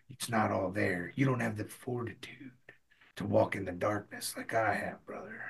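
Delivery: very quiet, low, introspective wrestling promo; hushed, menacing near-murmur to a rival; Jake the Snake. Acted. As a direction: brood softly, with long pauses between phrases. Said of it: "like, very quiet".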